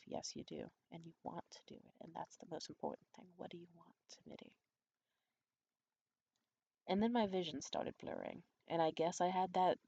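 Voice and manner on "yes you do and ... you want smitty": murmured egging-on, barely audible; inner voice. Retold or imitated. Woman coaxing herself in her head, very quiet. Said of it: "I thought to myself".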